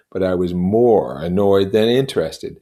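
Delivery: ordinary delivery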